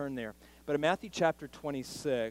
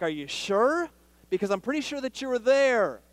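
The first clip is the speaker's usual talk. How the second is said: put-on higher, louder insistent girl's voice; pushy servant girl; 10 semitones higher, 12 dB louder than usual. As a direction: press him like a nosy servant girl. Servant girl pressing Peter.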